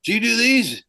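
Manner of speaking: boss at the door. Retold or imitated. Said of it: "he's like going".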